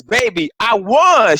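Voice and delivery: slow, high and proud; excited announcement. Retold or imitated. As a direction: tell your wife you won. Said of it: "I says".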